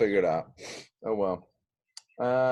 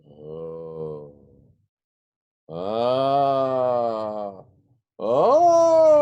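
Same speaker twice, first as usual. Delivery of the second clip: theatrical discovery cries; 4 semitones lower, 6 dB louder, much slower than usual. Pretend. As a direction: build to a big revelation wail. Pretend discovery, rising vocal noises.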